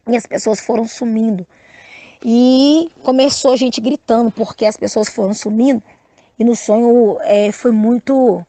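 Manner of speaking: usual speaking voice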